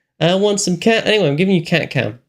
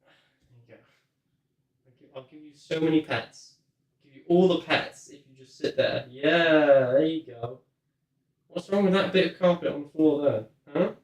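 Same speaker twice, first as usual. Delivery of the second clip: softer, tender petting talk; soft cooing to a cat; 5 semitones lower, 6 dB quieter than usual. Real, not acted. Owner coos softly to her cat while petting. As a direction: coo gently while stroking him.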